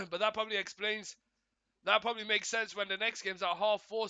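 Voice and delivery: normal talking voice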